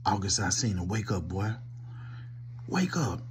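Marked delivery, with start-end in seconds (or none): none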